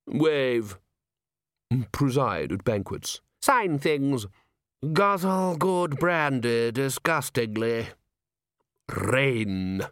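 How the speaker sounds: slow, separated list of duties; pompous committee member. Acted. A chair lists royal duties, slow and pompous. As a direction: draw out each duty grandly.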